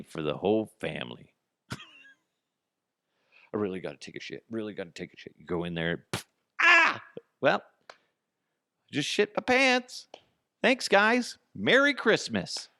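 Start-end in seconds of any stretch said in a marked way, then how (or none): 1.7-5.3 desperate mutter. Pretend. A desperate man rushing to the toilet, softer pained mutter. Acting desperate person before bathroom. 6.6-7.0 much higher, loud short shriek of fright; startled scream. Pretend. A man surprised by a toilet snake prank, loud high shriek. 7.4-12.7 much higher and louder, sarcastic whine; mock aggrieved victim. A prank victim thanking the family sarcastically, high whiny voice.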